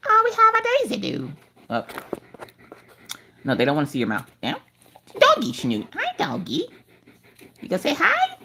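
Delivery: high, soft pet voice; doggy baby talk. Acted. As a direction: coo at the dog like a baby. Talking to her dog.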